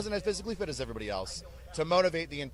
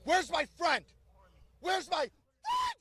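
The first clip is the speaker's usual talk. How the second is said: much higher, drawn-out shout; calling out; 14 semitones higher, slower than usual. Retold or imitated. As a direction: bellow it across the platoon. Drill sergeant calling out.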